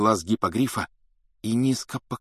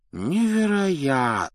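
long, drawn-out raised exclamation; amused amazement; 7 semitones higher, slower than usual. A narrator's reading. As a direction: marvel slowly, secretly entertained. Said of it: "воскликнул Дамблдор, явно изумлённый".

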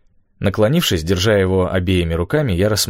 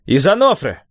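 high, carrying shout; calling out; 10 semitones higher, 4 dB louder than usual. A narrator's reading. Boy tells the hidden men their home, calling out. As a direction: call across the wind to strangers.

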